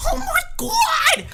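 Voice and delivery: screaming in a rage; furious shout. Retold or imitated. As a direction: howl it out in temper. Teacher with bad temper erupts.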